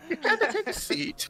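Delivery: high, soft attempt at posh; a strained high-pitched posh British voice; posh British accent. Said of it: "I can't do it".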